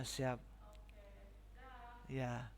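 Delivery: ordinary delivery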